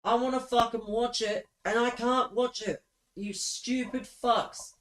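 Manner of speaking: fed-up yelling at the stream; frustrated outburst. Real, not acted.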